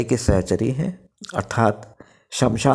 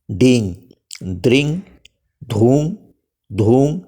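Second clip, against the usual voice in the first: slow motion; slower than usual. Shown as a demonstration. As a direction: spell out each syllable slowly. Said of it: "आप slow motion में कहूँ".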